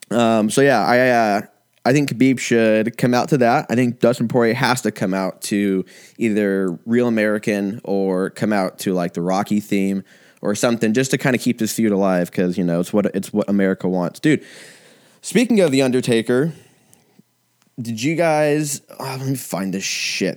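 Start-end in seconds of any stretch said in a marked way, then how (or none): none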